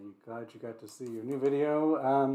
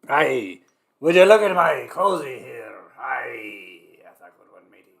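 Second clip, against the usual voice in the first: lightly hammed Scottish pirate; 10 dB louder than usual. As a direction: growl out a mock pirate "aye". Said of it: "Speaking of pirates".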